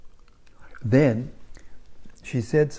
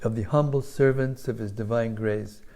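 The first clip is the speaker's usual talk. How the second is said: scripture reading; 6 dB louder than usual. Acted. Preacher reading the verse aloud to the congregation, solemn. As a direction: proclaim the holy text to the whole hall.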